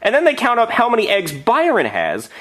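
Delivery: ordinary delivery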